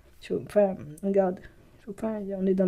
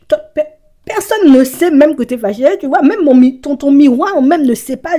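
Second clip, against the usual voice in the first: louder, higher emphatic rant; emphatic outburst; 5 semitones higher, 10 dB louder than usual. Real, not acted. Ranting to viewers about hidden anger, loud and emphatic. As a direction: drive the point home hard.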